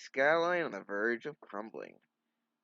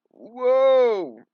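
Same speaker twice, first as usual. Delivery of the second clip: a loud, high yelp of surprise; startled cry; 18 dB louder than usual. Acted. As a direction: jolt out a big whoa.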